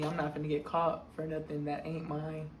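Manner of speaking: low, quiet deadpan repeat of I'm not finna; ironic self-quote. Shown as a demonstration. Repeating her own words to the viewers, quiet deadpan irony. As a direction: mutter it back dryly, savoring the irony. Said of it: "The, the irony".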